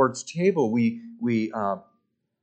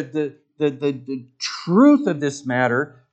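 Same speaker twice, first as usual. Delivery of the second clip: normal talking voice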